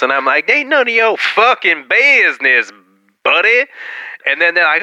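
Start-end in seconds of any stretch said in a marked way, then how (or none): 0.5-3.7 high, slow, drawn-out comeback; mock retort. Customer telling off a nosy worker, high mock retort. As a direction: stretch it out high to put them in their place.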